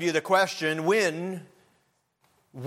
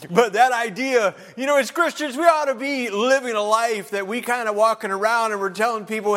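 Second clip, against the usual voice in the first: ordinary talk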